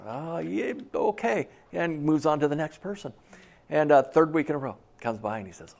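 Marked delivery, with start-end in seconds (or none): 0.1-1.5 hushed, hesitant start rising to a high okay; baffled acknowledgment. Acted. Pastor dodging the strange question, baffled and hesitant. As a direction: agree uncertainly, just to move on.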